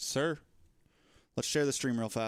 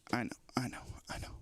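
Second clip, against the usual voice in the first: whispered soothing; 3 semitones lower, 8 dB quieter, much faster than usual. Real, not acted. Soothing the struggling cat.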